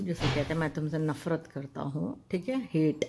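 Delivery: ordinary talk, nothing marked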